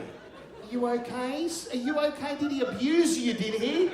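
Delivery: high, drawn-out busybody concern; put-on high voice, probing for an answer; concerned women onlookers. Women question the smacked boy, in high mock concern.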